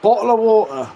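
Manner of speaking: English accent, "Bottle of water" with the Ts dropped to glottal stops; English accent, "Bottle of water" with no Ts, glottal stops instead. Shown as a demonstration. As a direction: say "Bottle of water" the London way, no T sounds. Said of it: "There's no T in any of that whole entire sentence".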